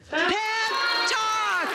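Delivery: high sung-out segment title; announcer voice. Acted.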